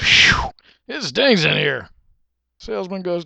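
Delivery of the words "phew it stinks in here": slow, loud recoil from a stink; acted disgust. Shopper leaning into smelly car, loudly disgusted. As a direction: blow out a loud phew in disgust.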